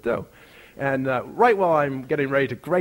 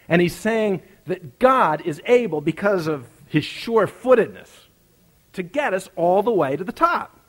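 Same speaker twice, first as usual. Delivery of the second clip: higher, louder preacher's voice, building to the climax; fervent preaching; 8 semitones higher, 5 dB louder than usual. Acted. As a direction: preach the story home with rising passion. Preaching at full force.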